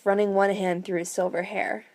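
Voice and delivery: ordinary delivery, nothing marked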